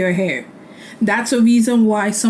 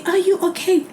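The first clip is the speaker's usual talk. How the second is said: mock caring partner; 9 semitones higher than usual. Mock partner asking like parent.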